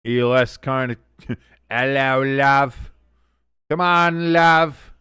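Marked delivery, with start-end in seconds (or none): 1.7-4.9 hammed-up bad English accent; drawn-out, badly faked English accent. Streamer coaxing Love for some magic, bad English accent. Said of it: "trying to do a very bad English accent".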